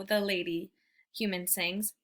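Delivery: usual speaking voice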